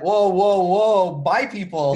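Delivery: loud, surprised whoa, whoa; startled whoa. Retold or imitated. Retelling his reply to the gym guy, loud and surprised. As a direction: recoil at the word people.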